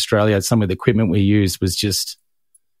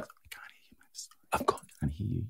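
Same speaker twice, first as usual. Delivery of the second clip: barely audible whisper; 5 semitones lower, 19 dB quieter, faster than usual. Shown as a demonstration. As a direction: mouth it with almost no voice. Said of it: "you can do this as well".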